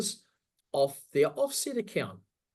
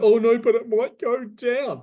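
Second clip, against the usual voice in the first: high, loud whiny panic, imitating the crowd; mock panicky investor; 7 semitones higher, 8 dB louder than usual. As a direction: ham up a scared seller who cries it might drop. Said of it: "everyone's going".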